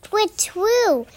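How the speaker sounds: high imitated owl call; high-pitched twit-twoo hoot. Owl fleeing the Gruffalo, high hoot.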